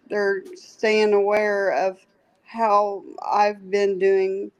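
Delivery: ordinary talk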